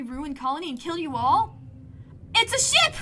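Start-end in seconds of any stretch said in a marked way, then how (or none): 2.3-3.0 high, loud excited cry; excited shout. Acted. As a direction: burst out with the news. Sudden excited discovery.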